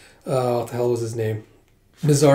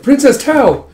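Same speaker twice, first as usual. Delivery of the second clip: high, loud call for the princess; urgent shout; 11 semitones higher, 8 dB louder than usual. Acted. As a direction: call out through a fire. Rescuer calls in burning building.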